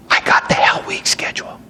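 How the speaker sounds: hushed conspiratorial whisper; whispered secret. Retold or imitated. Recruit whispering to his officer about the stolen schedule, hushed. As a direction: let him in on a forbidden secret.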